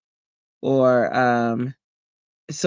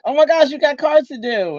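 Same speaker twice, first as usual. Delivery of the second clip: mock alarm; 12 semitones higher, 4 dB louder than usual. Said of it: "and I was like".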